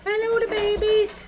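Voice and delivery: high-pitched cooing hello. Real, not acted. Keeper saying hello to the babies, high baby talk. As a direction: coo high at little animals.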